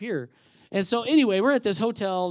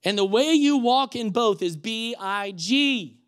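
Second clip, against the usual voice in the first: higher, louder, slow preacher's declaration; emphatic preaching; 5 semitones higher, 6 dB louder, slower than usual. Real, not acted. Preacher driving home the point to church, emphatic. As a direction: proclaim it slowly, landing hard on key words.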